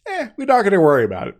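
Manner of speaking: breezy high-pitched shrug-off, quickly said; dismissive. Retold or imitated. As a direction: wave the worry away with a quick, high "eh". Voices Star Trek shrugging off concerns.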